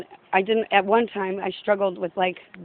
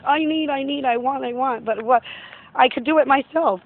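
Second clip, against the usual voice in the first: higher, louder mock whine of a stubborn soul; mock whining about wanting things; whiny stubborn person; 5 semitones higher, 3 dB louder than usual. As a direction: whine it like a stubborn sinner refusing help. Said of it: "you're just like".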